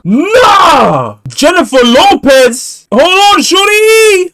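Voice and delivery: loud, high, drawn-out disbelief; shocked shouting. Real, not acted. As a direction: holler it slowly in amazement. Stunned by the name revealed.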